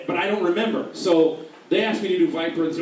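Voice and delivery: ordinary talk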